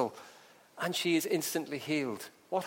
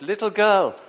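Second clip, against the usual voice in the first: raised, commanding call; loud voice; 6 semitones higher, 12 dB louder than usual. Acted. Jesus taking the dead girl's hand, loud command. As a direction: call out to wake her. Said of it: "said in a loud voice".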